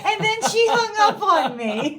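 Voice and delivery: laughing. Real, not acted. Laughing at the joke's ending.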